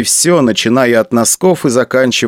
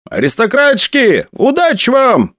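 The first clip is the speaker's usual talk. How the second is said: high jeering call, pitched up; mocking shout from crowd; 11 semitones higher than usual. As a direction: yell it across the crowd, mocking. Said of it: "выкрикнул кто-то из толпы".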